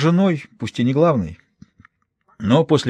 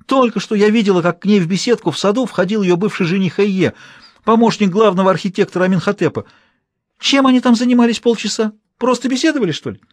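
heated insistence; 9 semitones higher than usual. A narrator's reading. Maid insists on the betrayal.

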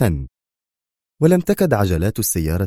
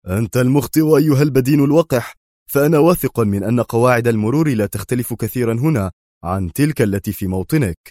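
raised, indignant retort, easing toward the end; angry shout; 4 semitones higher, 4 dB louder than usual. Acted. Bruce blaming the foreign driver, angrily shouting. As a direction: throw the blame straight back, angrily. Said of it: "فصاح فيه بروس في غضب".

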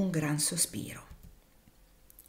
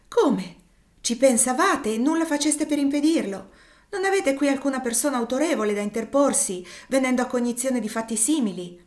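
higher, louder indignant questions; outraged; 6 semitones higher, 6 dB louder than usual. Acted. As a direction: confront him in disbelief. Ophelia outraged at St. Clair.

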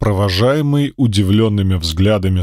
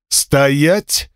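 furious command; 4 semitones higher than usual. A narrator's reading. Furious spirit stops him.

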